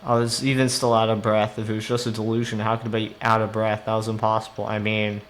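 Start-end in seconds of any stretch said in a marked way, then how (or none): none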